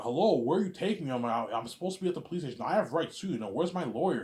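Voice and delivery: high, loud demanding of rights; mock indignant protest. Arrested kid demands his lawyer, high loud protest. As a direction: act out insisting on your rights.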